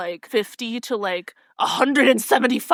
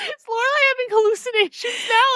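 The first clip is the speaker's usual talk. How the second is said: mock bewilderment; 13 semitones higher than usual. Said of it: "patting her on the back and I'm like".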